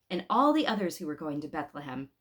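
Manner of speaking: usual speaking voice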